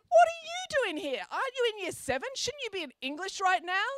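very high, loud indignant teacher voice. A narrator's reading. Teacher confronts the younger girl, high and indignant. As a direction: splutter at the intruder.

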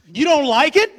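high, loud mock-wife indignation; offended wife. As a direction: shoot the question up in shocked disbelief. Wife taking offense, acted.

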